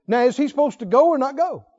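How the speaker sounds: ordinary talk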